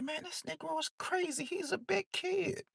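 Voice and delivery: high, soft voice for his teasing friend; lightly mock the buddy calling him a kid; friend's voice. Late friend teases him about games, high and soft. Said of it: "And he like".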